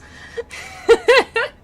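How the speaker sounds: high, loud laugh; helpless high-pitched laughing; real laughter. Real, not acted. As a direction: burst out laughing at your own joke. Laughs at own joke.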